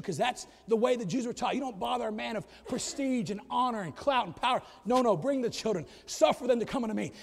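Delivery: normal talking voice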